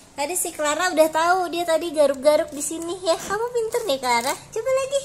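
high, loud cheering pet voice; 1 excited praise for the cat. Real, not acted. Owner praising Clara for scratching, loud high cheering.

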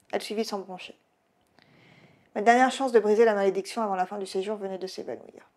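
ordinary delivery, nothing marked

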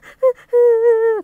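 high, loud mock sob; loud sobbing. Narrator imitating someone crying alone, loud sobbing. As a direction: wail out a storybook sob. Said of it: "he heard someone sobbing loudly".

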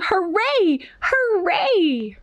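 high, gleeful cheer at reading volume; storybook yell. A narrator's reading. Norma Jean was very happy at field day, high mock yell. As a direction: cheer it high, without real shouting. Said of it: "She yelled".

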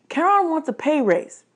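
high, sudden blurt. A narrator's reading. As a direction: blurt it out unthinkingly. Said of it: "I blurted".

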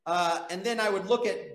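ordinary talk

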